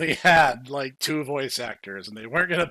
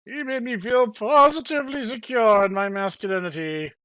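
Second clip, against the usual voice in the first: higher, louder swooning patron; portly bar patron; 6 semitones higher, 3 dB louder than usual. Acted. Bar patron gushing to the party, pitched up. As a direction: gush about the man.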